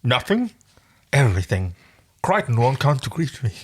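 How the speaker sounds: muffled by a finger in the mouth. Acted. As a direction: read it with a finger in your mouth. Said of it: "Like that finger in your mouth".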